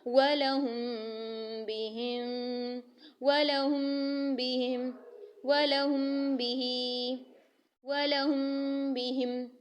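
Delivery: slow recitation, meem of वलहुम held humming through the nose into बिहिम; ikhfa with ghunna. Shown as a demonstration. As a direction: stretch वलहुम बिहिम, hiding the meem in a nasal hum. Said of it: "कैसे?".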